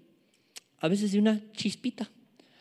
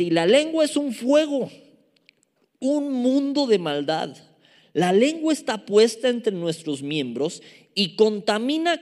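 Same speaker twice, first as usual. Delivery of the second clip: loud, raised preacher's reading; emphatic scripture reading; 5 semitones higher, 6 dB louder than usual. Acted. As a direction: proclaim the verse forcefully. Lee el versículo seis. (lectura bíblica enfática, en voz alta)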